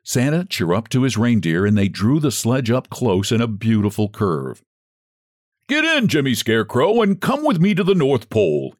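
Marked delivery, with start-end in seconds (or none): 5.7-8.8 cheerful call. A narrator's reading. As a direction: call the scarecrow into the sledge. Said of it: "he cried".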